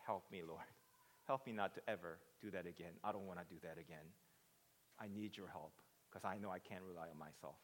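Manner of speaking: soft, low, earnest prayer; hushed admission of weakness; humble plea. Acted. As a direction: plead quietly for help, pitch dropped. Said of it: "in a way, he's implying".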